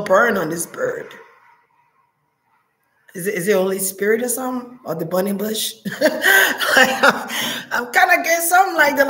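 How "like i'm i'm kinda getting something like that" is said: high, quick words through a laugh. Real, not acted. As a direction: laugh it out, half embarrassed. Laughing at her biblical vision.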